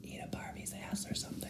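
barely audible quick whisper; whispered prompt. Shown as a demonstration. Host feeding a friend her line, whispered.

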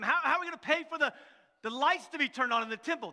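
loud, high indignant complaint. Retold or imitated. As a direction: scold them like outraged priests. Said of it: "They were indignant".

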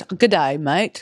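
low-pitched Aussie greeting; Australian accent. Shown as a demonstration. Podcaster insists it wasn't English, low Australian accent. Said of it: "That wasn't English".

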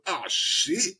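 high startled oh shit with a laugh; mock surprise. Retelling the brother's shocked reaction, high and startled. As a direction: blurt it out like the startled kid. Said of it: "the little brother was".

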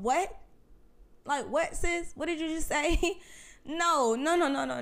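high, disbelieving what sis; incredulous what. Real, not acted. Telling women they can't show weakness, incredulous high-pitched disbelief. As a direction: react like you can't believe what you just heard. Shocked reaction to a quoted belief.

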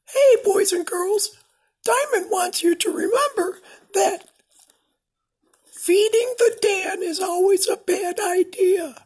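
high squeaky talking dog; dog's voice. Pretend. Dog voice addresses audience.

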